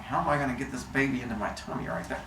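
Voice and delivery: quick soft plotting mutter; scheming aside. Retold or imitated. Cronus plotting while holding the baby, quick low mutter. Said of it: "holding on to the baby going".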